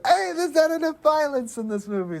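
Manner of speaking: high-pitched, exaggerated complaining exec; mock studio executive. Imagined studio executive demanding changes, mock high-pitched complaint.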